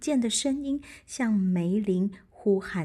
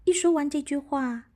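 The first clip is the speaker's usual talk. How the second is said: ordinary delivery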